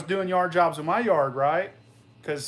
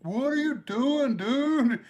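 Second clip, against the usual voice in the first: imitating the buddy; 9 semitones higher, slower than usual. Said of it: "he'd be like".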